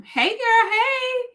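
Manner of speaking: put-on high sing-song hey girl greeting; gushing friend greeting. Vlogger mimics a friend's greeting, high and bubbly.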